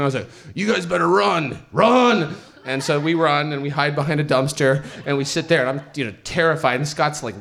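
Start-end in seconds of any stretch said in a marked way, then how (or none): 0.5-2.3 high, raised-voice warning to run; retold shout, climbing on the last word; urgent warning. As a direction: call it out, building to a yell.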